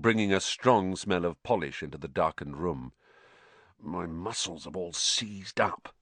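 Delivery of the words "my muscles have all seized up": slow, soft weary groan; worn-out, aching complaint. A narrator's reading. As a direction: groan it out, aching all over. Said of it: "he groaned".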